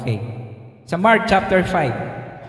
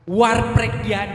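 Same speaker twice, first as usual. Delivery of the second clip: slow, deliberate "war preg yan"; slow emphasis; much slower than usual. Shown as a demonstration. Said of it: "Pag kayo mga, mga bigo".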